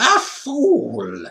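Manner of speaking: the witch cries out in scorn; witch crying out. A narrator's reading. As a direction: cruel witch's triumphant cry. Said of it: "she cried".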